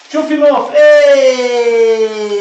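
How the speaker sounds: sudden loud shout across the room; high, loud call; loud yell. Real, not acted. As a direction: bellow it out to someone off-camera. Sudden yell during cooking stream.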